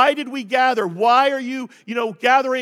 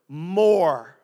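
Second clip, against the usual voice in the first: MORE drawn out long and weighty, on a lower pitch; emphatic MORE; 6 semitones lower than usual. Real, not acted. Preacher has congregation underline this word, MORE stretched emphatically. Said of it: "underline this word".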